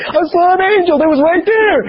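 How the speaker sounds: put-on bouncing with toddler excitement; excited child. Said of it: "he's all excited in the back seat".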